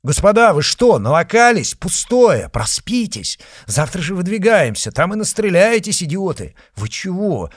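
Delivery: loud, high, scolding outcry; outraged shouting. Acted. Comrades shouting at the drunk duelists, outraged. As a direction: yell at them to sleep it off.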